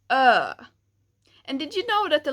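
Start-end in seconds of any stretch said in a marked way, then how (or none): 0.1-0.5 loud "ö" from deep in the throat; deep vowel. Shown as a demonstration. Teacher showing ö sound, deep. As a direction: let "ö" vibrate low. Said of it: "the final one".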